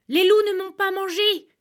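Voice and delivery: high, loud exclamation; loud cry. Acted. Shepherd shouts to his weeping wife, loud cry. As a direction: shout it out to be noticed. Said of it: "cria le berger".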